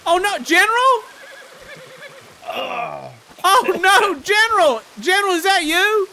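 loud, high alarmed cries; high, loud panicked calls to the general. Acted. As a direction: shout in alarm as the general falls. General falls from horse.

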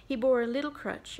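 ordinary delivery

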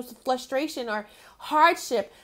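usual speaking voice

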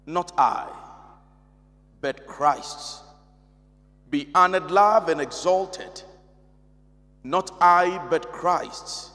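low, measured prayer with pauses between phrases. Real, not acted. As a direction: lead the congregation in an opening prayer. Opens the session in prayer.

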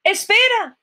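high, loud call; shouted plea. A narrator's reading. Prince calling after fleeing Cinderella, high shout. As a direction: call out urgently after someone. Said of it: "gritó el príncipe".

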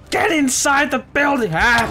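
high, loud, slow bellowed order ending in a cry; desperate shout. Real, not acted. Player shouting at villager during raid, desperate yell. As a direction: yell it with everything you have.

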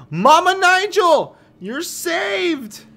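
high, loud cry of triumph; excited cheer. Real, not acted. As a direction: shout it like you just saved her. Triumphant rescue of Mama Nigel.

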